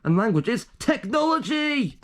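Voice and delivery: high excited shout. Pretend. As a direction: belt out the punchline. Shouted punchline.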